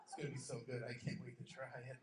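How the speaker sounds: ordinary talk, nothing marked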